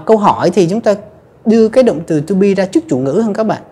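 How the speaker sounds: ordinary talk, nothing marked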